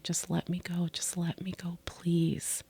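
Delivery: quiet, hushed begging, partly whispered; recalling begging to go home, hushed; soft pleading. Retold or imitated. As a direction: plead softly, almost gone. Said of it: "I was like".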